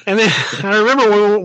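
ordinary talk

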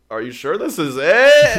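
high, loud, drawn-out question; mock uneasy doubt. Driver at a sketchy apartment complex, comically doubtful. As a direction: stretch the doubt out, exaggerated.

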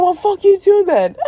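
high, loud fed-up snap back; mock angry retort. Stan snapping at the honking driver, loud and high. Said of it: "He's like".

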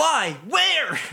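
high, alarmed mock cries; startled. Friends spotting darkness around them, startled high questions. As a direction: exclaim each word way up high.

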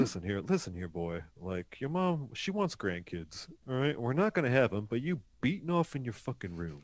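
low, quiet confiding dad; Burt Reynolds. Acted. Dad leaning in to his son, low confidential impression. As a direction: lean in close, keep it hushed and deep. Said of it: "he did a Burt Reynolds thing".

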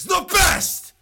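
loud high laughing yell; laughing shout. Real, not acted.

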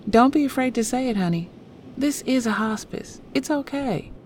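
high, reassuring Southern nurse voice; gentle Southern accent. A narrator's reading. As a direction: comfort a hesitant visitor in a gentle Southern lilt. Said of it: "The gentle southern intonation of the nurse".

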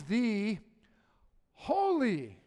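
"Holy" high, loud and set apart by a pause; stress on "Holy". Shown as a demonstration. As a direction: proclaim "the", wait, then ring out "Holy". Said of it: "look at the Holy Spirit's middle name".

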